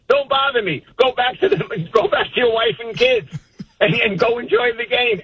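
high-pitched, amused imitation of a brush-off; dismissive mother. Mother sending grown son back to his family, mock dismissive. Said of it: "basically dismissive".

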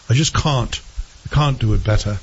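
put-on English accent with broad "cahn't"; hammed-up English accent, dropped r's; mock English accent. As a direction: imitate a bad English accent. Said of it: "Terrible English accent".

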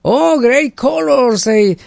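slow, high mock American accent; American passerby praising the paint, in an American accent; gringa imitation. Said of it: "le dice".

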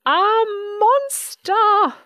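slow, high, loud cry of terror; screamed alarm. A narrator's reading. Baby Bird sees a supposed monster, high drawn-out scream. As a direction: scream it out, stretching each word. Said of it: "screamed Baby".

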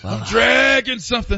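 hammed-up dad complaint; impression of co-host's dad. Co-host asked for the impression, hammed-up exasperated dad. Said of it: "Do the impression, please".

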